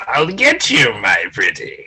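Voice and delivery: playful witch impression; Wicked Witch. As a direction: quote a storybook witch's threat. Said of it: "like the Wicked Witch said".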